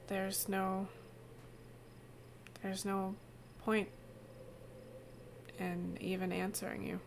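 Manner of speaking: quiet, hollow, hopeless murmur; despondent Tina. Acted. GM voicing the gray Tina to the players, quiet and hopeless. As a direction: speak as if nothing matters anymore. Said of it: "the figure shrugs and says".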